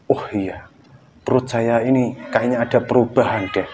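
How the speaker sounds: soft unvoiced whisper to himself; whispered discovery. Man in front of mirror whispering a realization. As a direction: breathe it out as a whisper.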